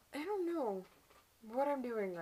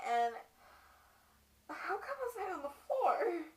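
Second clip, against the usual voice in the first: higher, halting, shaken recollection; acted distress; 6 semitones higher, 9 dB louder, much faster than usual. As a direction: relive the shock in a raised, stumbling voice. Acts wife recalling first beating.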